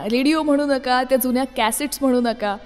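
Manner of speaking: ordinary talk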